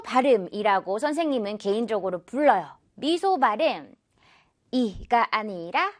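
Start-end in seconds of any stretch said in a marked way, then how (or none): none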